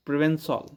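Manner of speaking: प्रोवेंसॉल ending in a rounded '-sawl'; pronunciation demo. Shown as a demonstration. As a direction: say प्रोवेंसॉल as written, with 'aw' in the final syllable. (प्रोवेंसॉल में आखिरी 'सॉल' का उच्चारण)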